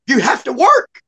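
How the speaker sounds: loud, high mock command; insistent jail lady. Jail lady ordering inmate to work, insistent.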